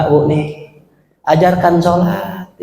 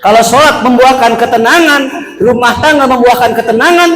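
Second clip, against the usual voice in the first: raised, higher preaching call; fervent preaching; 9 semitones higher, 4 dB louder than usual. Acted. Preacher rousing the mothers, raised and higher. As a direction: lift the congregation with it.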